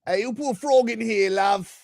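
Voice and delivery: put-on high old English lady voice; squeaky little old lady from England. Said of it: "Why are you talking like an old English lady?".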